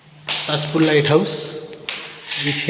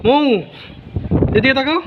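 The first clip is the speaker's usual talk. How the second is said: calling out; 9 semitones higher than usual. Real, not acted. Calling child to look.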